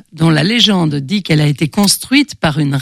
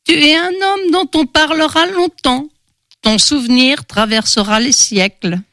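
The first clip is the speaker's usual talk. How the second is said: put-on high old crone prophesying; prophesy in a high, put-on old woman's voice; old fortune-teller; 8 semitones higher than usual. A narrator's reading. Old beggar reading Victor's palm, high prophetic crone voice. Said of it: "la mendiante suivit lentement la ligne de vie".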